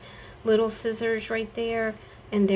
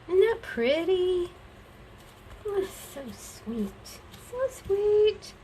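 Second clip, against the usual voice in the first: higher cooing delight; gushing; 10 semitones higher than usual. Real, not acted. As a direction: coo over the pretty paper. Admiring the gift wrap.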